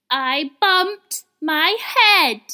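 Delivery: loud, very slow sulky complaint; cross little voice. A narrator's reading. Little rabbit sulking up from the well, cross and slow. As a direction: grumble it out crossly. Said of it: "answered the cross voice again".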